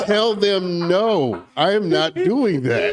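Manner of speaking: loud, slow, deliberate no; firm emphatic refusal. Real, not acted. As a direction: plant your feet and refuse. Firm refusal, repeated advice.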